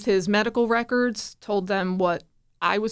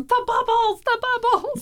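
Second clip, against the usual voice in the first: put-on much higher, bright little kid squeal of joy; be the three-year-old thrilled to reach the bubbles; excited toddler; 4 dB louder than usual. Mom voicing her son right after his first steps, excited toddler. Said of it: "and he's going".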